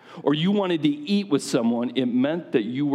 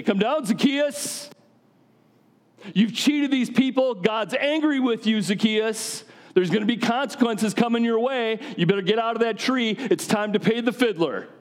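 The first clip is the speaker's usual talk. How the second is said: much higher, stern mock denunciation; mock condemning preacher; 10 semitones higher than usual. Crowd imagines Jesus scolding Zacchaeus, higher stern mock rebuke. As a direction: play the judgmental crowd's version of Jesus condemning the cheat. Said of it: "Crowd wants to hear Jesus say".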